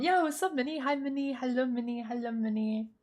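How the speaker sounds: high, sing-out hello; high-pitched greeting, easing down; cutesy greeting. Real, not acted. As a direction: say hi in a high little voice.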